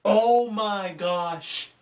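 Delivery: ordinary talk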